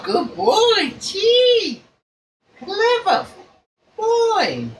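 delighted high pet talk. Real, not acted. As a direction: coo up high like talking to a clever pet. Playing coin game with bird.

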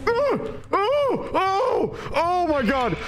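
very high shrieking oh my God; terrified screaming. Real, not acted. Player caught by the monster, shrieking very high. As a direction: shriek in real terror.

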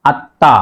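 আত্মা said at-tã with a held t; careful at-ta for আত্মা; doubled consonant. Shown as a demonstration. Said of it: "double হবে".

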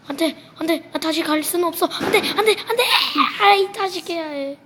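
high, loud desperate no no; panicked pleading. Real, not acted. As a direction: beg the game not to let you die. About to die in game. (다급하게 비명 섞인 애원)

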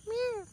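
high mock meow; imitated meow. Meowing at kittens.

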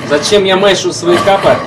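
ordinary talk, nothing marked

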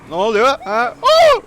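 shocked cries rising into a high squeal; startled yelps. Real, not acted. Attacked in the game, yelping in shock. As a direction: yelp as the blow lands out of nowhere.

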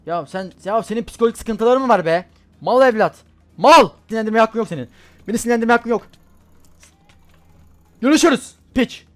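raging at a player who killed him; furious yelling. Real, not acted. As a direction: lose it at the player. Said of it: "çok sinirlendim".

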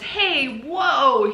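high, loud startled "whoa"; startled yelp. Acted. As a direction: yelp in shock as your pants vanish. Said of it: "Mister Tickham goes".

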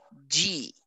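"di" said "jee"; D softened to J. Shown as a demonstration. Teacher introduces the D rule, "di" as "jee". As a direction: say "di" like the start of jeep. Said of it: "vai ganhar a fonética".

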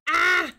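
a sudden loud "ah"; sudden yelp. Real, not acted. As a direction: yelp out in alarm. Startled cry during game.

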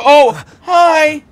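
high, loud startled hi; startled greeting. Real, not acted. Gamer startled by the cutscene vampire: high loud hi. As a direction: jump at the sudden vampire.

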